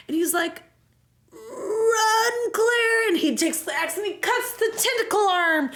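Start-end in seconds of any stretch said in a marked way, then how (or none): 1.3-3.1 high, strained, drawn-out shout; mock desperate cry. Steve telling Claire to flee, mock desperate cry. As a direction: wail a hammed-up warning.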